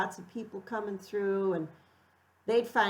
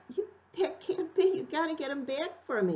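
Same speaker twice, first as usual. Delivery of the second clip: tearful protest; 7 semitones higher, faster than usual. Retold or imitated. Dog given away, retold distress.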